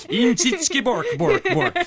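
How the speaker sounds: high, loud mock-Swedish gibberish; hammed-up Scandinavian cartoon voice; Swedish Chef. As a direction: babble it in a silly Swedish accent. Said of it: "watching the Swedish Chef".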